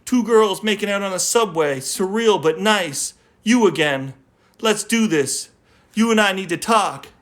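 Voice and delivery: deadpan poem recital; poem reading. Acted. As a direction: read each line like a punchline. Reads short poem lines aloud.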